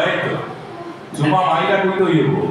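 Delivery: usual speaking voice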